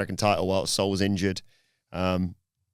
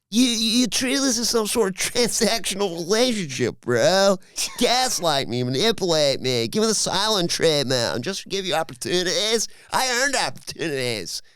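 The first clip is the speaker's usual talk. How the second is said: Californian bro voice; 9 semitones higher than usual. Retold or imitated. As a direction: play Sol as a whiny Californian bro. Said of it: "You do that in Californian?".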